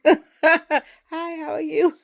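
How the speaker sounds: high, loud, phony-sweet greeting; smiling, put-on niceness; fake smiley greeting. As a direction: greet them with exaggerated fake friendliness. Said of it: "Smile and be like".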